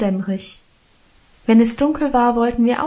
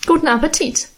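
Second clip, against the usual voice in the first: pert, higher-pitched send-off; snippy; 6 semitones higher, faster than usual. A narrator's reading. Minchen leaving bread and water, snippy. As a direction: say it snippily, voice lifted. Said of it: "sagte schnippisch".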